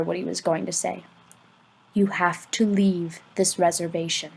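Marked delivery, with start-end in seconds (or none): 1.9-4.3 1 hushed. A narrator's reading. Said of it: "leaned closer ... didn't even want the trees to hear".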